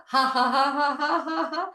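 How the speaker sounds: high, loud ha-ha-ha; forced laugh, climbing high; laughter. Shown as a demonstration. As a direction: pump out a deliberate laugh. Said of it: "fill it up with laughter".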